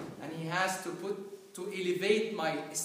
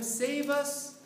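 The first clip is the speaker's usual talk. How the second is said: crying out to God; 9 semitones higher, 5 dB louder than usual. Pretend. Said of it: "Can we cry out to him".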